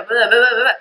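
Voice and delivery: usual speaking voice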